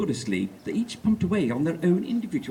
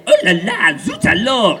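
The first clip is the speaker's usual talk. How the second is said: breathless French exclamation; French accent; 2 semitones higher, 10 dB louder than usual. Acted. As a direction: gasp it out in a hammy French accent.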